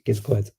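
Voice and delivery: giz a quid run together; "giz a quid", give us squashed to giz; Scouse contraction. Shown as a demonstration. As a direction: say "giz a quid" as one quick Scouse phrase. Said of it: "you would run those together".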